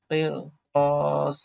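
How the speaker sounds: drawn out slow teacher reading; slow model reading; slow blended reading. Shown as a demonstration. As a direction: stretch each syllable slowly for learners. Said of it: "slow joined reading of word".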